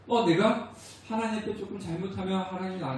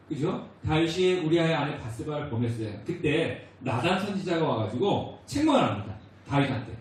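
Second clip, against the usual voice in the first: normal talking voice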